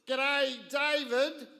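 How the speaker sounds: a big friendly broad Australian g'day; broad Australian accent. Retold or imitated. Broad Australian, a neighbour on the phone greeting the farmer father. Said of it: "who had the most Australian accent".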